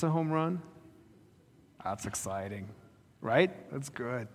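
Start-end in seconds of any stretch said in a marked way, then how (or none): none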